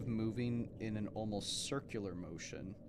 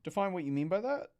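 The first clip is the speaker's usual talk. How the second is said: mock high voice; 8 dB louder than usual. Reading chat question in silly voice.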